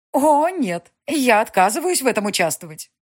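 резкий отказ, гораздо выше и громче; отрубить, как ножом; резко отрезать. Acted. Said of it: "отрезала Татьяна Александровна". (резко, категорично отрезала)

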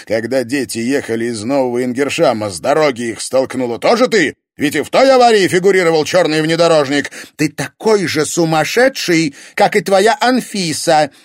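high, loud bitter exchange; shouting match. Acted. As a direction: fight back with contempt. Spouses' shouting quarrel.

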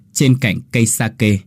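usual speaking voice

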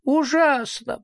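acted suffering whine; moaning.

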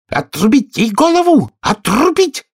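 high, shrieked royal command; full-voice scream. A narrator's reading. Enraged queen orders Alice's execution, screaming at full voice. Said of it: "завопила во весь голос".

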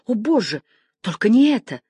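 anguished cry. A narrator's reading. Sister hears her brother is dead, anguished cry. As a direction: cry out against the terrible news.

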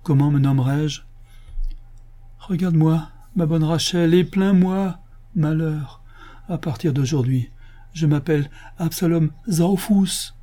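high, plaintive self-pity; woeful lament. Acted. Absalom telling good Rachel his new name, woeful. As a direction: invite pity for your misfortune.

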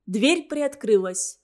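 ordinary delivery, nothing marked

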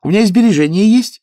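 high, loud flustered gabble; pilgrim woman eager to please, high and loud; flustered chatter. Acted. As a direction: rattle it off anxiously. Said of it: "в волнении затараторила женщина".

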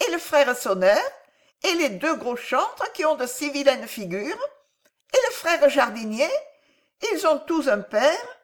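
put-on high-pitched child, insistently curious; questioning little boy. A narrator's reading. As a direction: play a small boy piling up questions. (voix aiguë de petit garçon curieux)

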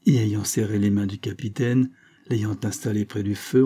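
ordinary delivery, nothing marked

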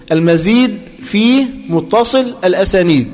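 slow, deliberate: المزيد في متصل الأسانيد, word by word; teacher dictating المزيد في متصل الأسانيد at a slow, even pace; slow dictation. Shown as a demonstration. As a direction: spell out المزيد في متصل الأسانيد slowly for the class to write.

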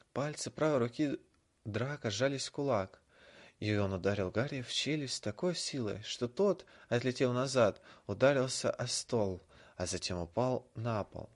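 usual speaking voice

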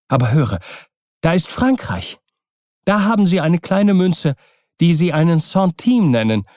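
high, lively, hopeful urging; eager wife Kokua. Acted. Kokua urges Keawe off to Tahiti, eagerly.

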